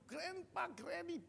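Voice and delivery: high, lightly voiced children calling; a hint of kids running in; child's call. Grandchildren greeting grandparents at the door, high call.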